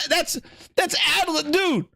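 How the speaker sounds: ordinary delivery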